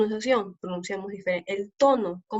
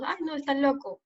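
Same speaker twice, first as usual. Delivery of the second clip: casual everyday speech; 4 semitones higher than usual. Shown as a demonstration. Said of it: "tal vez diríamos".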